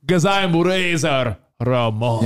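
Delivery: slow, cocky drawl; slow macho boast; Razor Ramon impression. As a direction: lean on the name with swagger. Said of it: "they show him, like, dismissing women".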